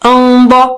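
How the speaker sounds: انبا with a thick, full nasal hum; slow, sustained full-mouthed hum in انبا; heavy ghunna. Shown as a demonstration. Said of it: "نون ساکنہ بھی بہت موٹا اونچا ہو گیا".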